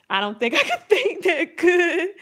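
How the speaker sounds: high-pitched mock protest broken by laughter; laughing self-doubt. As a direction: laugh through a pretend worried excuse. Voicing a doubtful viewer, laughing.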